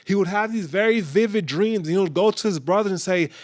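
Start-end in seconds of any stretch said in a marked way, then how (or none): none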